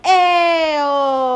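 loud, high teasing ayo; mock shouted ayo. Family teasing Angie as she comes down the stairs, loud shouted ayo. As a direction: call it out like a whole family teasing her.

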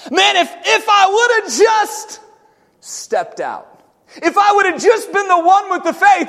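loud, impassioned preaching. Acted. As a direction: preach it with full fire. Preaching at full force opening.

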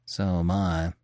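Manfred answering Terry quietly and evenly. Acted. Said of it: "said Manfred quietly".

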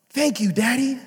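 put-on high, small child voice; grateful little boy; son's thanks. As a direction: son thanking his dad. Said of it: "and he finds out".

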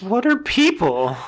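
high, loud baffled hermit voice; dazed hermit. Pretend. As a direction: act like a hermit who never met people. Hermit pope voiced mockingly.

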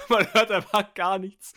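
distorted, clipping loud mic. Real, not acted. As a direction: overdriven microphone crackle. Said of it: "Du, du übersteuerst so komplett". (übersteuertes, verzerrtes Mikro)

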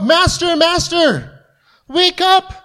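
high, urgent cry to wake him; panicked disciples. Retold or imitated. Preacher voicing disciples waking Jesus in the storm, high frantic plea. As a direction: shake a sleeping man awake in panic. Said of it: "They woke him up".

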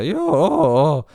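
high, cracking mock-broken voice; voice breaking. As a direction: squeak out a fake-calm yes as the voice breaks. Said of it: "sellaisella murtuvalla äänellä". (murtuvalla äänellä vastaten)

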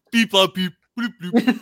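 high, loud robotic beep-bloop noises; alien beeps. Pretend. Mocking aliens with beep-bloop sounds. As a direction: play a chirpy alien machine.

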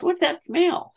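high, innocent sniffing question; oblivious grandmother. Retold or imitated. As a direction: play dumb about the smell. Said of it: "and she somehow goes".

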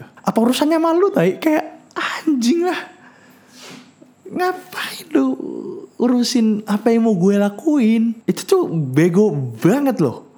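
high-pitched, slow, strained outrage; exasperated rant. Real, not acted. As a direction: rant at the nosy neighbours, voice cracking. Ranting at nosy neighbours.

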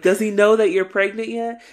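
loud, high, tactless query; mock blurted question. Manny coming downstairs to ask, mock blurted question.

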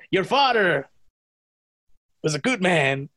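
long pause after "father"; halting delivery, "Your father", a long gap, then "was a good man"; Christopher Walken. Retold or imitated. Listener showing how the actor delivers his line, long mid-sentence pause. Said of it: "these, like, long pauses between it".

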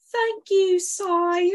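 high, loud Victorian urchin voice. Shown as a demonstration. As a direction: beg like a street child from Dickens. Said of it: "talk like a little Victorian urchin".

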